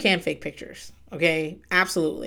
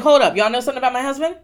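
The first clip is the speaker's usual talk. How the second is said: mock demand; 5 semitones higher, 5 dB louder, faster than usual. Imagined confronting the gossipers.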